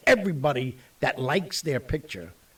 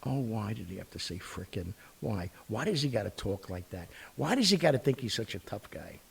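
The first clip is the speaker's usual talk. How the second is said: a hint of a disapproving father, softer; voicing his father; 3 semitones lower, 7 dB quieter than usual. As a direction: grumble like a dad embarrassed by his son. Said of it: "here's my father now".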